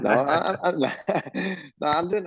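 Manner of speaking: giggling, barely getting words out; laughing. Real, not acted. Jockey laughing off the host's teasing, words breaking into laughs.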